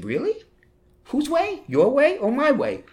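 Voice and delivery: high-pitched, loud mock disbelief; the Lord, incredulous. The Lord looking down at a reluctant priest, incredulous. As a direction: put on a squeaky, amused challenge from above. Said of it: "the Lord's looking down at me saying".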